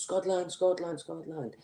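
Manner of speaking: chanting Scotland at a Scottish soldier; mimicking an Iraqi man. Iraqi man chanting at the soldier, mock foreign voice. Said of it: "turned to me and goes".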